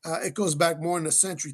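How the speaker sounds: normal talking voice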